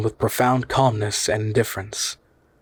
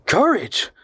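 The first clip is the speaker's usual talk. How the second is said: much higher, outraged burst; indignant cry; 11 semitones higher than usual. Acted. Harry stung by his uncle's doubt, crying out. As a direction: fling the word back at him. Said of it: "I cried".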